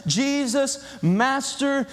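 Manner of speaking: high, separated calls to the Lord; pleading cry. Acted. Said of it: "they've poured their plea".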